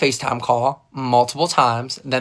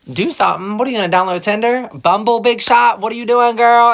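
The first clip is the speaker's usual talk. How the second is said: higher and louder, playfully mocking; mock teasing; 7 semitones higher, 5 dB louder than usual. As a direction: tease her like a nosy bestie. Teasing coworker about her plans.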